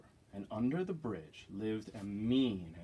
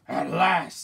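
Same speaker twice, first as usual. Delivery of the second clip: loud, raised troll cry; 5 semitones higher, 10 dB louder than usual. Acted. Troll finally facing the big goat, loud eager voice. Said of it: "said the troll".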